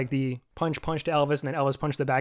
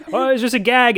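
high, louder, airy brush-off; breezy mock dismissal; 9 semitones higher, 8 dB louder than usual. [name] waving off the punch, high and airy. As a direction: shrug it off like nothing happened. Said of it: "And he's just like".

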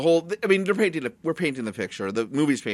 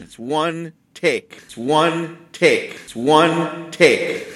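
slow, each repeat louder, stress on one; slow emphatic repeat; 4 semitones higher, 6 dB louder, much slower than usual. Shown as a demonstration. Host insists to cohost, slow and building louder. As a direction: hammer "one" harder every time. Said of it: "This is all one take".

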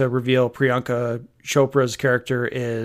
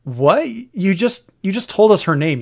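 exasperated; 7 semitones higher, 5 dB louder than usual. Real, not acted. Exasperated rant at the dialogue.

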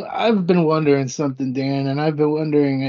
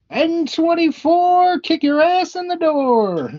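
bingo caller; 12 semitones higher than usual. Acted. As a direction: belt the number like a bingo caller. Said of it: "hear some more of your bingo calling".

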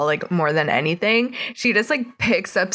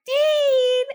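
high, drawn-out mock call. Boy is called away mid-kiss, high mock call. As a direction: call a kid's name in a high voice.

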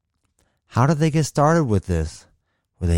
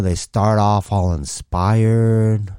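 ordinary talk, nothing marked

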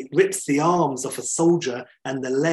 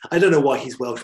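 ordinary talk

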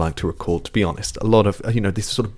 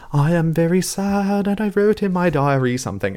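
higher-pitched, over-enunciated mock English folk singer voice; affected English accent; 6 semitones higher than usual. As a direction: put on a precious English accent, pronouncing every word. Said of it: "particularly very English kind of sounding voice where they pronounce every word".

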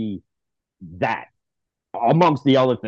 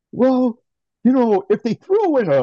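high, louder musing to himself; mock pondering; 10 semitones higher, 5 dB louder than usual. Fan reading trade posts on Twitter, mock pondering. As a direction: weigh it up in a raised, hedging voice. Acts out weighing a silly trade.

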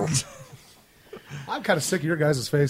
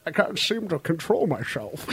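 Bane voice; 7 dB quieter, slower than usual. Acted. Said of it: "if he breaks into his Bane voice".

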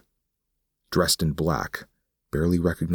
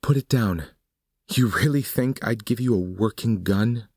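much higher, contemptuous woman's voice; scornful woman; 8 semitones higher than usual. Acted. Suzanne mocks Nick for trusting her: scornful, higher. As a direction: sneer down at him.

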